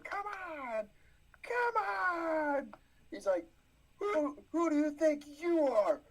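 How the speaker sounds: high, shouted mock bowler taunt; pumped bowler. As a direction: yell it like a hyped-up bowler.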